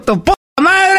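imitated furious high shout; angry insult shouted back. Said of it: "Y me dijo". (la señora le grita enojada, grito furioso)

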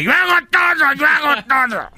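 high, loud, whiny wife complaint; mock wife. Husband mimicking his wife's complaint in a high whine. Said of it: "parece mi mujer porque dice".

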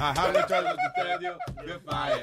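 catchphrase squealed high through laughter; laughing, high voice. Retold or imitated. Mimicking the toy, laughing.

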